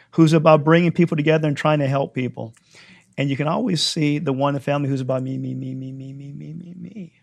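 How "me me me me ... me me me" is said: repeated chant fading away to very soft; mock self-centred. Preacher mimicking the selfish one in a family, mock self-centred. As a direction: let the repeats dwindle into a tiny voice.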